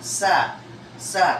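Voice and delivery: Sa said as a clear single syllable; sounding out Sa. Shown as a demonstration. Teacher modeling the syllable Sa for pupils. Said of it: "Tapos yung S".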